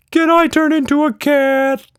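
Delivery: very high, loud little creature voice; a cheeky tiny monster, full of glee; Quatch Monster voice. Acted.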